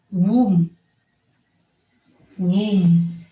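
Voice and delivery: womb ending on M; silent B. Shown as a demonstration. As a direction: say "Womb" with no B sound. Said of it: "words that have the silent B".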